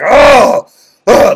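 loud, high, angry snorting groan. Retold or imitated. Preacher acting out Jesus groaning at the mourners, loud angry snort. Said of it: "like he was angry".

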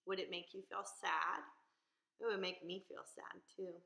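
usual speaking voice

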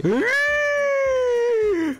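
long, very high-pitched squeal; sustained high squeal of panic; terrified squeal. Real, not acted. Player as the wave hits, terrified squeal.

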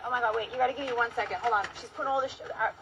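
ordinary talk

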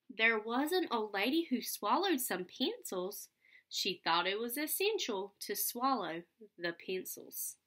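lively read-aloud storytelling voice; sing-song picture book reading; reading to children. Acted. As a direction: read it out with bounce for little kids.

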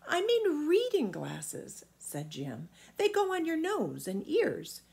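put-on high boyish voice; a small boy correcting the giant; boy Jim answering the giant; young boy.

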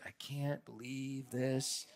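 under-the-breath weary disbelief; eye-rolling disbelief. Acted. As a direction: sigh it out, fed up. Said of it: "The rolling of eyes".

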